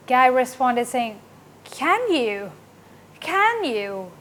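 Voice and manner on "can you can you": keen, interested short question with swooping pitch. Shown as a demonstration. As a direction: sound all interested, pitch rising then falling on "Can". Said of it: "He seems all interested in it".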